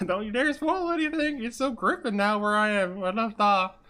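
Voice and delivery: high falsetto character chatter; high-pitched put-on voice, easing down; joke "real voice". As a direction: keep the silly high put-on voice going. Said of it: "This is my real voice".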